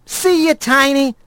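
cheeky friend; Blaze voice. A narrator's reading. Blaze character voice.

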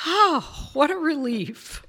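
high, sighing relief; relieved. Retold or imitated. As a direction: recall a private wave of relief. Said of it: "I remember thinking".

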